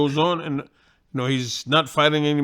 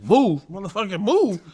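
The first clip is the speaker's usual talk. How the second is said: a disbelieving echo, mildly raised; incredulous echo; 4 semitones higher than usual. Retold or imitated. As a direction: throw the order back in disbelief. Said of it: "I'm like".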